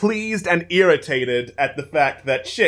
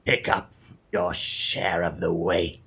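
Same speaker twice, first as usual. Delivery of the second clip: lizard folk voice; 8 semitones lower, 3 dB quieter than usual. Acted. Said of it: "keep doing the lizard folk voice".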